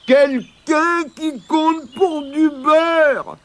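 high, slow, drawn-out lament; tearful wail. A narrator's reading. Weeping stranger answering from under the bridge, wailing. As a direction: wail self-pityingly, stretching each word. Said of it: "ils entendirent des pleurs".

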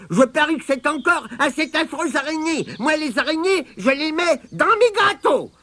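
put-on high, piercing old woman's grumble; grumbling granny. A narrator's reading. Mémé Croche cursing spiders, grumbling in piercing voice. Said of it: "grommela Mémé Croche".

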